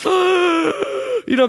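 sputtering gasp for air. Retold or imitated. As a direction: gasp as if surfacing from water. Popping up after swallowing water.

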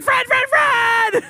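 high, loud desperate yells; frantic shouting. Retold or imitated. Mom yelling for her husband from a carriage, frantic shouts. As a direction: shout his name across the park. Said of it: "my mom's like".

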